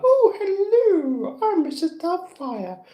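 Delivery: very high, loud fluting lady voice; big drawn-out falsetto greeting; Missus Doubtfire. Acted. Imagining himself as a trademark character, high hammy impression. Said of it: "doing Missus Doubtfire".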